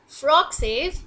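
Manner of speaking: ordinary talk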